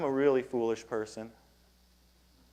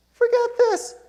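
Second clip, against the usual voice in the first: angry outburst; 5 dB louder than usual. Retold or imitated. Angry after parking ordeal.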